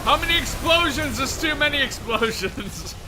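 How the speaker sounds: excited laughing. Real, not acted. Explosions, laughing.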